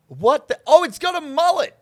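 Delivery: high, loud surprised exclaim. Real, not acted. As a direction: react with delighted shock. Surprised seeing bear.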